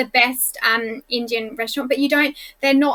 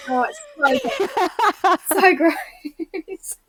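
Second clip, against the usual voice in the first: stretched slow, higher disgust; drawn-out disgust; 5 semitones higher, much slower than usual. Real, not acted. Reacting to the dating show with slow disgust. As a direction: drag out so so gross.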